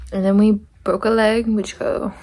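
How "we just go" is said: ordinary talk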